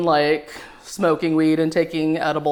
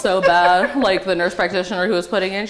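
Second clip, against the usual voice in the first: ordinary talk, nothing marked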